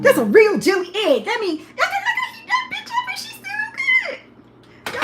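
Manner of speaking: high and loud with excitement; thrilled food showoff; excited. Real, not acted. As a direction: gush about the perfect egg. Excited about the jelly egg.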